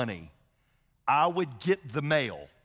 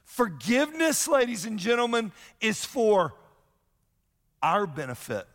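preaching emphasis; 8 semitones higher than usual. Real, not acted. Pastor driving home his main point, rising preach. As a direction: proclaim it to the whole room.

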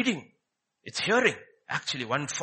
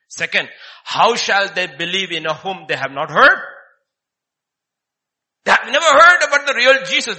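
much louder and higher, crying it out; preaching at full force; 4 semitones higher, 12 dB louder than usual. Acted. Preacher crying out about the real Jesus, loud. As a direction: thunder it to the congregation.

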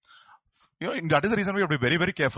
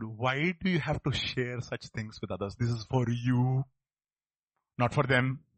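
gentle pastor; 4 dB quieter, slower than usual. Retold or imitated. Pastor voiced gently, slow.

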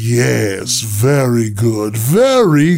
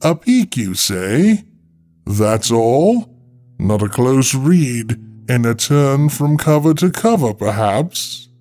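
higher, arch skeptical librarian; teasing disbelief; 4 semitones higher, slower than usual. Acted. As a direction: needle him with mock doubt.

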